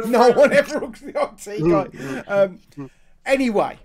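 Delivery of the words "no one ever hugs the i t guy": laughing through the words; laughing echo. Pretend. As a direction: repeat it while cracking up. Echoes with a laugh.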